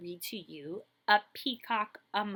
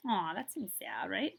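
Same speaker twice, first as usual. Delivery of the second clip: ordinary delivery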